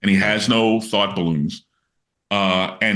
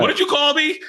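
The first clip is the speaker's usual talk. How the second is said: Chris Rock impression. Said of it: "And he goes".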